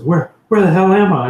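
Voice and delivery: bewildered and scared, just woken; frightened. Acted. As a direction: Steve waking up lost, a frightened high blurt. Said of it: "you know, frightened".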